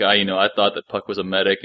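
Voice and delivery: ordinary talk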